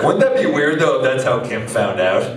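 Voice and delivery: ordinary delivery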